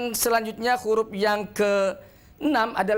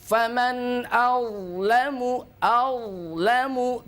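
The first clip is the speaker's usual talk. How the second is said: slow recitation with held breath; 3 semitones higher, much slower than usual. Shown as a demonstration. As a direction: recite awlamu slowly, no air escaping. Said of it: "Benar-benar ditahan udaranya".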